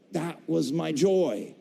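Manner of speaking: ordinary talk, nothing marked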